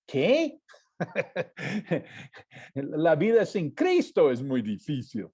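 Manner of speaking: ordinary talk, nothing marked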